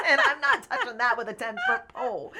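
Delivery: loud high laugh spilling into the words. Real, not acted. Guest joking that her husband does the finances, laughing loud. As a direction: laugh through the line, loud.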